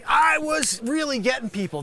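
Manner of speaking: ordinary talk, nothing marked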